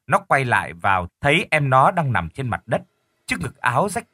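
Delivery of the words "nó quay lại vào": usual speaking voice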